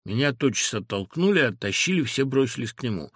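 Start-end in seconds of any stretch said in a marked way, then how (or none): none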